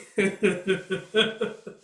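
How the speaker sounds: loud, high burst of laughter; real laugh. Real, not acted. Reader cracking up at the panel, real laughter.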